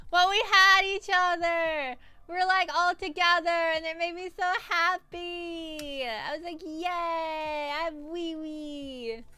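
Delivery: high, drawn-out happy squeal; gushing happiness. Real, not acted. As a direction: gush in a high, stretched voice. Gushing over happy reunion.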